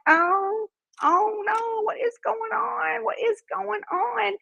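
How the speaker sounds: high-pitched retold dread, slowing down; mock dismay. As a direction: replay your rising disbelief. Alarmed at crowd boarding trolley.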